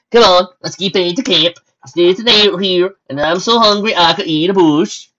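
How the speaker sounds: loud, high character voice; stallion soldier. Acted. Hungry stallion urging comrade back to camp, loud and high.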